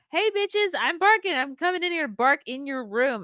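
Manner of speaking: high-pitched voice for a cheeky dog; dog proudly announcing itself in a high voice; brash barking dog. Acted. Dog bursting into the baby's room to bark, high brash mock voice. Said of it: "as loud as she can, starts barking".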